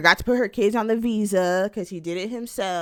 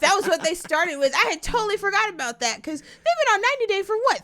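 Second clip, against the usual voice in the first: excited recall; 13 semitones higher, 4 dB louder than usual. Real, not acted. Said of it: "excited realisation".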